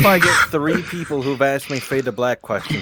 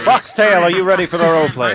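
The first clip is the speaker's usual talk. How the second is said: hype host call; 6 semitones higher, 5 dB louder, faster than usual. Real, not acted. Host hyping players one by one.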